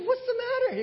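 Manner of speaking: high, laughing question; mock high concern. Son asking his dad, high and laughing. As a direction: ask it high through a laugh.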